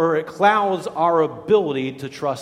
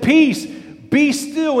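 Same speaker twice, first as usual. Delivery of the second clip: commanding calm; 8 semitones higher, 6 dB louder, much slower than usual. Retold or imitated. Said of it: "He said".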